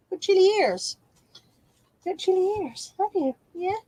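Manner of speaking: high, sing-song cooing at her dog. Real, not acted. Owner calling her sleepy dog, high cooing baby talk. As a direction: coo up high like talking to a puppy.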